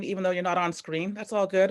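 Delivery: ordinary delivery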